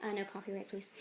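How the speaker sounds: ordinary delivery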